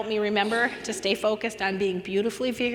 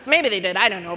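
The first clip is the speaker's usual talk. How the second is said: ordinary talk, nothing marked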